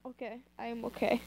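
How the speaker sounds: usual speaking voice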